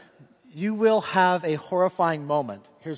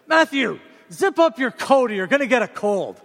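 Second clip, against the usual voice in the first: higher, louder scolding dad call; indignant parent; 6 semitones higher, 5 dB louder than usual. Retold or imitated. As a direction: snap at the kid like an irritated father. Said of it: "I'll be all angry and indignant".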